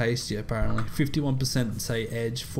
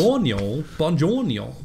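mock Italian; 4 semitones higher, 5 dB louder than usual. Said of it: "you're doing so many things at once".

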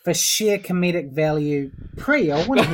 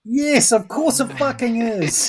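exasperated; 6 semitones higher, 4 dB louder, slower than usual. Real, not acted. Exasperated at obvious answer.

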